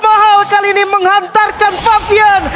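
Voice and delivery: screaming, stretched-out hype call; fighting game announcer. Real, not acted. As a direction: roar the kill call to the crowd. Caster calls a maniac kill.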